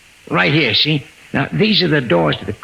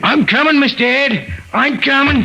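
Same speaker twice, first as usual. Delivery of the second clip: calling back; 12 semitones higher than usual. Acted. Maxie calling back to Mister Ed from the corridor. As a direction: shout back so he hears from far off.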